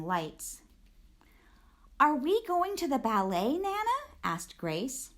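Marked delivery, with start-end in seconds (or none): 2.0-4.0 higher, eager question rising to Nana; excited child. A narrator's reading. Grace asking her grandmother, eager and high. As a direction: ask like a thrilled little girl.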